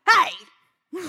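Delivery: put-on high, loud bark at the kid; mock yell. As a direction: yell hey across the house. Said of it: "and I'm like".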